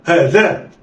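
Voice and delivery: "ها ذا" joined in one quick go; snatch "ها ذا" with no pause; snatched together. Shown as a demonstration. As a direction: blend "ها ذا" like one word. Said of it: "اخطف".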